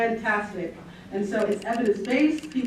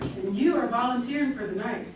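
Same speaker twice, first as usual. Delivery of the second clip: normal talking voice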